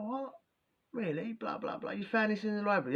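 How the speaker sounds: high, breathy mimic of eager lads; squeaky, thin imitation of their questions; mock excited squeak. Mimicking the cellmates quizzing him, high and soft.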